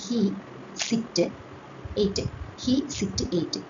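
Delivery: "he sit ate" പതുക്കെ, ഓരോ ഭാഗവും വേറെ വേറെ; "hesitate" എന്നതിനെ he, sit, ate എന്ന് മുറിച്ച് നീട്ടി പറയുക; syllable split. Shown as a demonstration. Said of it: "ഇങ്ങനെയാക്കുന്നു". (വാക്ക് മൂന്നായി മുറിച്ച് പതുക്കെ ഉച്ചരിക്കുക)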